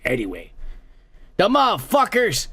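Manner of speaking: ordinary delivery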